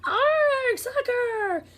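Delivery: very high loud pirate cheer; crew yell. Acted. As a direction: echo the captain. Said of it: "the crew yelled".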